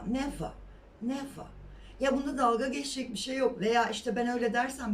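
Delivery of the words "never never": "Never." with a soft English v, said lightly; correct never. Shown as a demonstration. Teacher modelling "Never." softly for Turkish students. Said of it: "never değil mesela".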